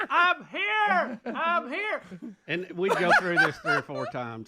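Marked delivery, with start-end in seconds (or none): 0.1-2.0 high, tiny I'm here with a laugh; Horton's Who voice. Retold or imitated. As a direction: squeak like a speck-sized Who calling out. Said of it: "I'm like".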